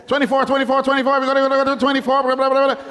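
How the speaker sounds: rapid high auctioneer patter. Pretend. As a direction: rattle off the bids like an auctioneer. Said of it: "I feel like an auctioneer".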